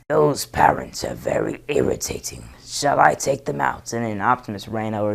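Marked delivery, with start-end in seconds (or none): 0.1-3.9 loud, gruff robot soldier; lightly voiced gruff robot; Ironhide impression. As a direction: offer it like a trigger-happy soldier. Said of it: "the next one is Ironhide".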